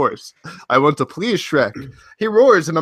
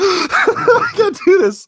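laughing helplessly; 5 dB louder, much slower than usual. Real, not acted. Breaking up reading fanfic.

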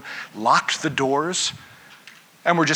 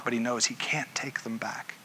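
whispered, much softer; hushed whisper; 2 semitones lower, 9 dB quieter than usual. A narrator's reading. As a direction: drop to a whisper of regret. Peter's regret after denial.

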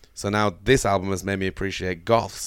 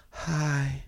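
soft, higher, drawn-out mock introduction; softly mocking a drowsy presenter; sleepy host impression; 6 semitones higher, 6 dB quieter, slower than usual. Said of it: "Get a cup of coffee in you".

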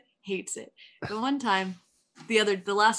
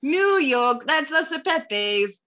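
raised, hammed-up New York accent; 3 dB louder than usual. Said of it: "I could do a New York accent".

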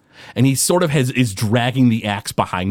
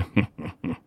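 villain laugh; 6 semitones lower, 9 dB quieter than usual. Acted. Villain laughs after threat.

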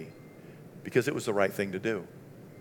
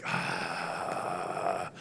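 1 reluctant groan; 7 semitones lower, 8 dB quieter than usual. Retold or imitated. Reluctant reply to the Lord.

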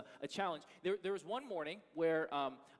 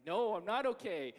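exasperated reply; 6 semitones higher, 7 dB louder than usual. Retold or imitated. Frustrated answer to son.

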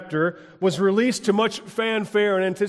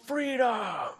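raised, drawn-out echo of a battle cry; mock cry; 5 semitones higher, 6 dB quieter than usual. Preacher echoing the movie's cry, restrained mock shout. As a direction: call it out high, holding the vowel. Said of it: "and he cries out".

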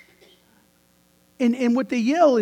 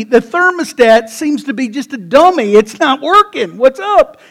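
rising preaching; 4 semitones higher, 9 dB louder than usual. Real, not acted. Preaching builds to a climax.

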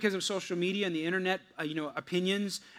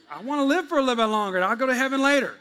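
mock answer; 6 semitones higher, 6 dB louder, faster than usual. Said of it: "I'm like".

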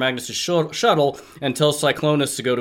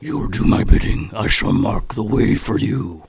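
deep whispered monster; 4 semitones lower, 4 dB louder than usual. Acted. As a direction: hiss it like an ancient evil. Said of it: "then he says".

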